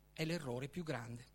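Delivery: ordinary talk, nothing marked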